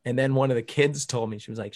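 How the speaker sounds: usual speaking voice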